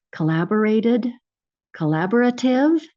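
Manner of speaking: ordinary talk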